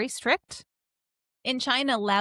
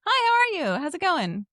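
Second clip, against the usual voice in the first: high, louder chirpy greeting; fake friendly; 9 semitones higher, 5 dB louder than usual. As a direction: greet brightly without wanting an answer. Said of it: "being polite and friendly and not rude".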